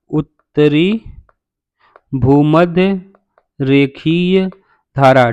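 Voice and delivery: word by word, with long pauses; spaced-out, measured dictation; slow dictation. Shown as a demonstration. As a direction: dictate slowly for students writing it down. Dictates name for students to write.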